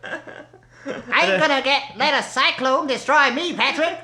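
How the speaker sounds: high, slow cartoon sponge voice with a laugh; goofy SpongeBob impression. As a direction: stretch it out like a giggly cartoon sponge. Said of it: "Your voices were a lot better".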